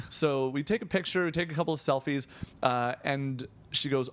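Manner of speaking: ordinary talk, nothing marked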